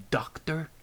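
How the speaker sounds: a snide, overly precise title; condescending enunciation. Acted. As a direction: spell out her title as if beneath you. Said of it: "He enunciated her title like it was below his own".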